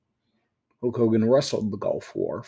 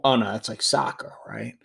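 ordinary talk, nothing marked

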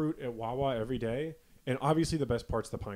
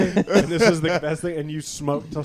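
louder, laughing jab at a former tough guy; laughing mock taunt; 4 semitones higher, 7 dB louder than usual. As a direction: tease him through laughter, half-hearted mockery. Mocking the chain-smoker friend.

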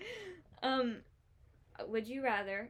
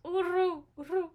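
loony bird call; 8 semitones higher, 7 dB louder than usual. Retold or imitated. Imitating Becky the bird.

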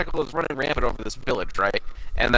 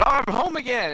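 higher, wistful imp voice; imitating Impmon; 9 semitones higher than usual. Impmon seeing his old house, lightly voiced wistful. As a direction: lift it up, homesick. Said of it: "Imon's like".

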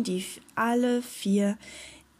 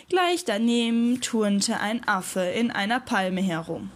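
ordinary delivery

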